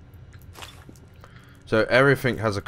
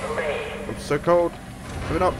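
exasperated shouting; 13 semitones higher than usual. Real, not acted. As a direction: yell in frustration at the pod. Trapped, pod toggling cold.

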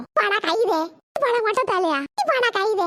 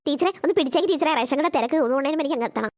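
lower, fast, fretful mother; hurried mother; 7 semitones lower, much faster than usual. Acted. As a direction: rush it out while leaving. Mother hurrying away.

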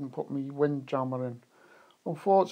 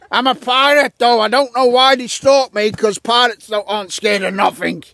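blustering pirate; 2 semitones higher, 9 dB louder than usual. Pretend. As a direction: play a wary but boastful pirate. Plays a pirate being stalked.

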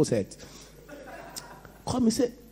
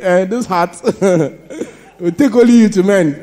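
laughing; 5 semitones higher, 8 dB louder than usual. Retold or imitated. Retold prayer, laughing.

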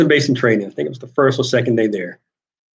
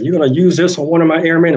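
loud, high mock yell; imitated yelling commander; 7 semitones higher, 6 dB louder, faster than usual. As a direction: bark it like an angry commander. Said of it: "he's screaming".

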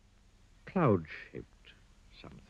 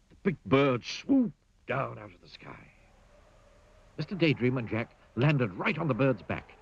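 plain reading voice

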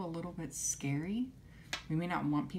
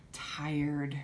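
normal talking voice

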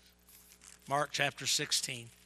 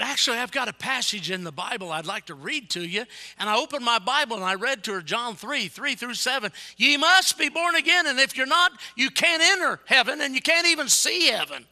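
loud, high pulpit shout, building in force; full-force preaching; 10 semitones higher, 12 dB louder than usual. Acted. Preacher reading John three to a seatmate, shouted preaching. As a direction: thunder the verse out to the congregation.

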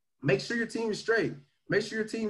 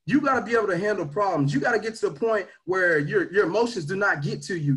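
normal talking voice

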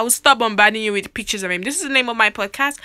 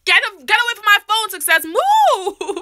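playful shooing; 9 semitones higher than usual. Real, not acted. Chasing sister from phone.

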